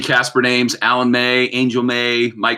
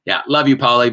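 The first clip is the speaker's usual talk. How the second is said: ordinary delivery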